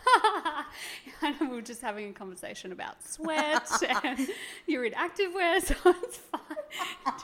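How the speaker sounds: giggling through the words; trying not to laugh. Real, not acted. Host laughing over guest's nerves.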